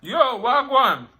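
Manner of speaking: loud, high, slow drawn-out greeting; a hawker calling to passers-by; street hawker calling out. Retold or imitated. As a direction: call out across the street. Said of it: "man said".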